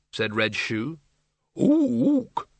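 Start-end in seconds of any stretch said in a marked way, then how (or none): 1.6-2.5 very high orangutan ook; ape hoot. Acted. Orangutan librarian speaks.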